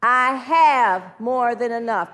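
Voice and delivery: slow, loud, emphatic declaration; forceful drawn-out affirmation; leading an affirmation. Shown as a demonstration. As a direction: proclaim it slowly, stretching "have".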